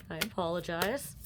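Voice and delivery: ordinary talk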